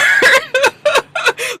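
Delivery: high squealing laugh; giddy laugh. Real, not acted. Host laughing at her own crush, high squealing laugh.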